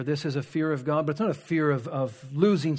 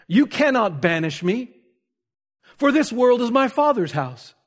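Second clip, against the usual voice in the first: defiant retort; 7 semitones higher, 6 dB louder than usual. Retold or imitated. Acted defiant reply to empress.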